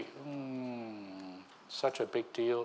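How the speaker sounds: a listener shrugging off marriage, mock dismissive. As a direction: brush marriage off like it's nothing much. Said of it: "Then you say".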